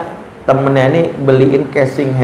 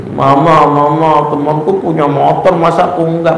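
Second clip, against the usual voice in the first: rengekan anak; 4 semitones higher than usual. Retold or imitated. Anak merengek minta motor.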